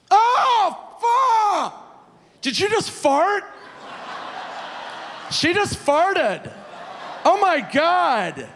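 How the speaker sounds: very high, loud public accusation; hammed-up horrified outcry, drawn out for the whole bar; mock outrage. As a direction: make a scene with fake disgust. Loudly fakes disgust to shame her.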